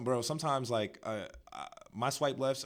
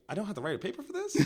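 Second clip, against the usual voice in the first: mock surprise; 8 semitones higher, faster than usual. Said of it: "I was like".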